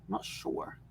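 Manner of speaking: breathy whisper, barely voiced; unsure whispered aside. Real, not acted. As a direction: drop to a private whisper.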